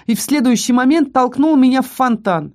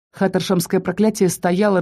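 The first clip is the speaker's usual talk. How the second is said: ordinary delivery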